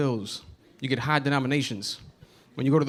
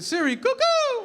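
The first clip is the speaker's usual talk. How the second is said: very high, slow cuckoo call; bird call at Siri; 4 dB louder, slower than usual. Retold or imitated. Comic calling to Siri like on the block, high cuckoo. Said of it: "I said".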